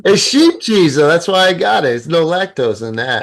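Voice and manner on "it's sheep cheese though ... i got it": higher, louder insistence; excited protest. Real, not acted. Defending the cheese he left for his friend. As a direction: push back eagerly.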